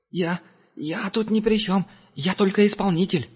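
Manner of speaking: the angel pleads innocence to Herman, high and timid; stammering captive. A narrator's reading. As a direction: stutter like a cornered coward.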